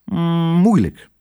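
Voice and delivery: long, high musing hum first; hesitant, thinking aloud; pondering. A narrator's reading. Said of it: "Kwark stond even te peinzen".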